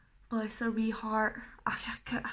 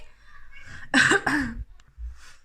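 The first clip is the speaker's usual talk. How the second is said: loud coughing fit; coughing, can't talk; real cough; 3 semitones higher, 6 dB louder than usual. Real, not acted. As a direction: cough hard. Coughing fit mid tutorial.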